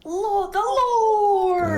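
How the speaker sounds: high, slow, loud wail; dramatic cry. Acted. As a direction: drag out the cry to the heavens. Dramatic cry about the Lord.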